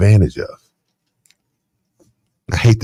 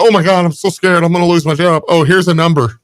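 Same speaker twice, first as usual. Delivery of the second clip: higher-pitched fake sob story; mock panic; 6 semitones higher than usual. Co-host mimicking driver's sob story, mock panic. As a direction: play up a scared plea, clearly put on.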